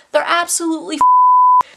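high, loud burst of exasperation; exasperated outburst. Real, not acted. Vlogger ranting about crazy trips there, exasperated outburst. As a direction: blurt it out loudly, heading into a swear. Exasperated rant, censored swear.

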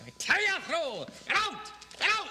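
angry shout. Acted. Boss throws actor out.

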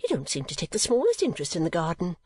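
high-voiced prattling mother; Mrs. Nickleby. Mother chiding her daughter about the garden, high-pitched and aggrieved. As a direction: complain in a high, chattering voice.

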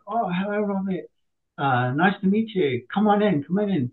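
lightly voiced customer greeting him; a hint of a cheerful hostess; friendly lady.

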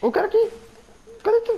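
high urgent call for teammate; excited calling out. Real, not acted. Searching for teammate mid-fight.

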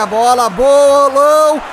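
high, loud chance call; excited shout. Real, not acted. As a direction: shout the player's name in thrill. Big chance in football game. (grito empolgado de narrador)

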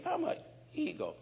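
high, quiet voice giving in; hesitant acceptance; timid bird admitting. Retold or imitated. The bird quietly saying it is an eagle.

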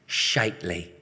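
ordinary delivery

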